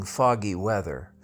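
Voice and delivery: plain reading voice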